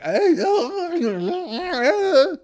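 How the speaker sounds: high-pitched wailing warble; long mock scream, wobbling up high. Comedian mimicking a singer after a burning swallow, high wailing screech. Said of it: "you scream like [name]".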